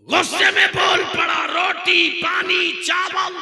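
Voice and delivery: high, loud fiery sermon shouting; preaching at full force. Real, not acted. Preacher shouting the story's climax to the crowd, full force. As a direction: hammer the punchline home to the crowd.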